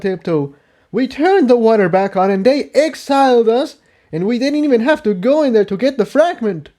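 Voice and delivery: high, bitter character voice; a frustrated pony pleading his case; voiced young stallion.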